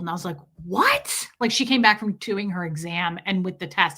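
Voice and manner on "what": shocked what. Retold or imitated. Shocked reply to the vet.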